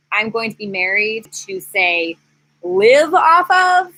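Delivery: ordinary talk, nothing marked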